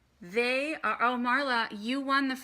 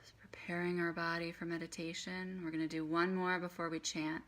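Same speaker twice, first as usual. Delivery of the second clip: soft, unhurried meditation guide; quiet voice with long pauses for breath; guided breathing; 7 dB quieter than usual. Acted. Leads breathing exercise for meditation.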